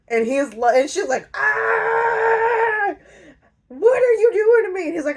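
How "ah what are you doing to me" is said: high shocked squeal, rushed; mock outraged shriek of a startled woman; shrieking alarm. Woman waking in a man's room, shrieking in alarm. She wakes in his room.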